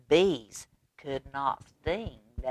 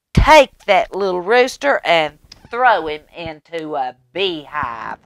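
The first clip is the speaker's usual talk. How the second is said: loud, bossy king giving orders; storybook king in a temper; angry king; 2 semitones higher, 11 dB louder than usual. Acted. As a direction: bark it out like a cross king.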